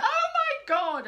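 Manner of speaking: high, loud, slow shocked cry; shocked mum. Retold or imitated. Mum shocked by child's internet history, high loud cry. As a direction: gasp it out like a horrified mother. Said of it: "and his mum went".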